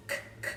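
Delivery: K as a voiceless click-like "kuh", twice; isolated letter sound. Shown as a demonstration. Teacher picking out a sound in walks, isolated voiceless K. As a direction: repeat just the sound of K, not its name. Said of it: "actually two sounds".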